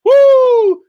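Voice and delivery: loud high joyful whoop; big high celebratory yell; excited woo. Real, not acted. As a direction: whoop like the show was the best. Hyped about last night's live.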